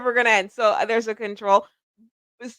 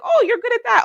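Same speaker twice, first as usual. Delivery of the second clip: high, bright mock praise; impressed viewer; 13 semitones higher than usual. Viewer meeting her, mock impressed. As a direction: play an impressed friend. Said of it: "maybe you'll be like".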